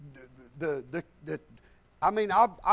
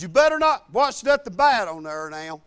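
stern grandpa warning; 8 dB louder than usual. Retold or imitated.

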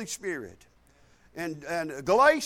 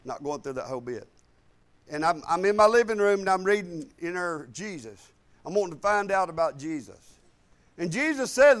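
normal talking voice

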